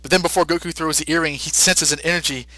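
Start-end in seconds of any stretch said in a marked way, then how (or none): none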